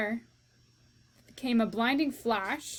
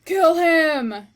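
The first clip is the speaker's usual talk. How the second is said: very loud, higher bellow; shout; 6 semitones higher, 12 dB louder than usual. Acted. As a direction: bark the order furiously. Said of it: "shouted Cook".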